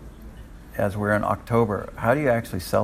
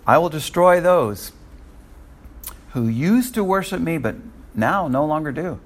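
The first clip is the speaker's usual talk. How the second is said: higher-pitched declamation, loud opening; forceful scripture reading; 6 semitones higher, 3 dB louder than usual. A narrator's reading. Preacher reading God's warning to former worshippers, declamatory. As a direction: proclaim God's judgment from the text.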